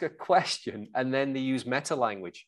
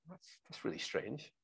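much softer, lower musing; mock puzzled; 5 semitones lower, 14 dB quieter than usual. Salesman puzzled that a client declines, musing softly. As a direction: puzzle it quietly to yourself, Columbo style. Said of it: "you're almost puzzled".

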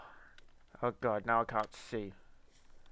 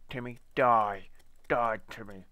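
shouting at Timmy; 10 dB louder than usual. Real, not acted. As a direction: yell the kill order at the monster. Yelling at a mob in the game.

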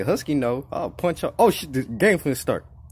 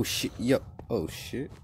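ordinary talk, nothing marked

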